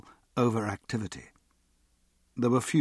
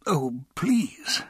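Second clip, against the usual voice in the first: higher, pleading tremor; tremulous; 5 semitones higher than usual. A narrator's reading. Desperate man begs his friend for help, tremulous. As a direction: plead with a touch of a tremble. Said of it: "his voice tremulous".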